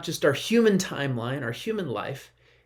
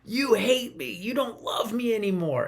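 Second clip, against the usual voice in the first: put-on higher child voice, wailing you hate me; mock child yelling; 7 semitones higher than usual. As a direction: play the hurt kid shouting back. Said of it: "starts yelling and says".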